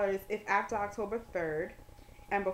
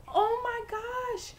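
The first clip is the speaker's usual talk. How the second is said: high, drawn-out thrilled exclamation; excited; 13 semitones higher than usual. Retold or imitated. Dean hearing of the student's ordination, very excited. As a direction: imitate her squealing with excitement. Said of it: "She was just so excited".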